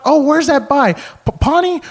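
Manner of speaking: very high, loud prying voice; eager caller imitation. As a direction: imitate an excited old lady asking. Acted caller keeps questioning him.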